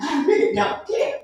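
high, slow mock defiance; imitated little-boy sass; defiant child. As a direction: draw it out like a bratty kid.

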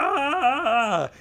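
high yelping mock roar; mock monster yell. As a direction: leap and yell it.

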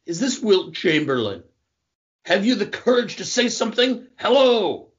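high-pitched mock calls to whoever is there; hammed-up anxious hellos; calling out. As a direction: call into the silence, pitched well up. Calling out to unknown caller, quote.

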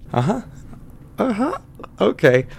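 high, rising "Uh-huh"; humoring acknowledgement. Acted. Bartender humoring customer's odd drink request, high and questioning. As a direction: pitch each one up like a wary question.

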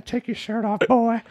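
put-on high leering old man; lecherous old man, high pitched; creepy old man voice.

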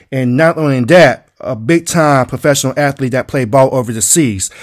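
loud, punchy host introduction; big sports-show welcome; hype announcer intro. Acted.

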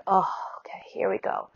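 breathy whisper bracing for a push. Retold or imitated. Woman in labor telling herself here we go, whispered. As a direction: whisper it, steadying yourself as labor pushes.

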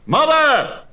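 high, loud cry across the hall; shouting. A narrator's reading. Perseus warning his mother in the throne room, loud high shout. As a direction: call out to her in alarm. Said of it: "he shouted".

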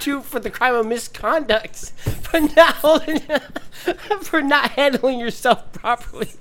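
high giggly read; cracking up mid-sentence; laughing. Real, not acted. Laughing while reading charge.

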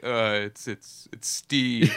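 hesitant, lightly disguised caller; fake voice. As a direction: stall as you badly fake an identity. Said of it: "I put on a fake voice".

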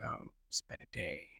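drained, sunk low and soft; tired murmur to yourself in the parked car; weary sigh. Retold or imitated. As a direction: let the whole day sag out of you.

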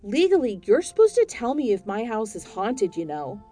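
furious buyer on the phone; angry phone complaint. Acted. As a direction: lay into the agent. Angry call to agent.

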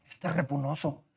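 very soft, half-swallowed insult; muttered aside. Acted. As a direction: mutter it under your breath. Abisai muttering about Saúl.

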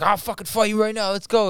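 high-pitched mock challenge; going off. Storyteller voicing angry man challenging phone guy, mock fighting talk. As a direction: imitate a man itching to fight. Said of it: "was still going off".

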